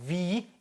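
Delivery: wie with a long drawn ee; long ee sound. Shown as a demonstration. Teacher showing long vowel in wie, drawn out. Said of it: "이하고 에 길게 발음하면".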